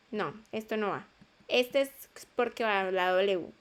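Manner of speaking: normal talking voice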